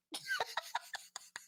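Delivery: soft, high giggle; laughing. Real, not acted. Amused at the excuse for cursing, giggling. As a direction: let out a quiet run of giggles.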